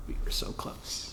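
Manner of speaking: breathy, broken near-whisper, playing at tears; half-joking sob over the lost season, very soft; mock crying. Fan mourning a cancelled basketball tournament, mock-sobbing whisper. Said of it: "I'm hysterically crying".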